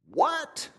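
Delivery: high, squeaky incredulous what; mock disbelief, shoot it up high; stunned at what he just heard; shocked what. Reacts to own mock prayer.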